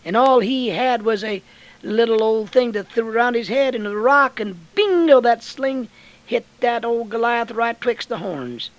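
loud, high, fiery preaching of David's sling; preaching at full force. Acted. Preacher to the congregation about Goliath, fiery and loud. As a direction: belt the story out like a revival preacher.

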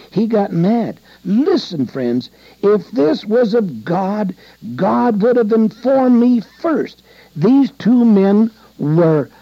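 full-force preaching at the pulpit; emphatic preaching. Acted. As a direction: drive it home to the congregation. Preaching rises again.

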